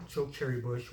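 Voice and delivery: ordinary talk, nothing marked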